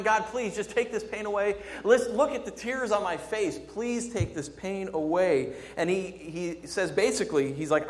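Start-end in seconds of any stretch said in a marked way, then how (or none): 0.0-5.5 higher, urgent plea, understated anguish; acted pleading. Preacher voicing David in pain begging God, mild pleading. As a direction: beg earnestly, drawing out the final request. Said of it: "He's in so much pain, he's crying".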